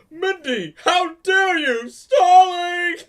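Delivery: high, drawn-out wailing shout; loud, overblown scolding wail; mock outrage. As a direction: cry out in hammed-up indignation.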